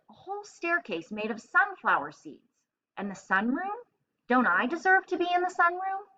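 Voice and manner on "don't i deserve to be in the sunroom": high, whining plea; indignant hamster. A narrator's reading. Hamster demanding his due, high and whiny. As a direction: pitch it up like a put-out little rodent.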